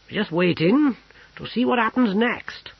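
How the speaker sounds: lightly voiced cook. A narrator's reading. Mealy speaks.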